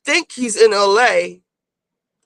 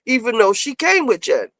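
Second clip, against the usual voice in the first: ordinary talk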